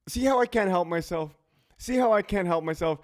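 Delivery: tickled and loud, caught out by his own joke; excited, laughing. Real, not acted. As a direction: say it amused at yourself. Laughing at himself.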